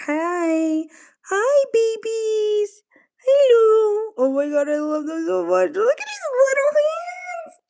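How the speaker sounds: very high, slow cooing; a very high cooing voice for cute animals. Real, not acted. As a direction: coo at the babies. Baby talk to raccoons.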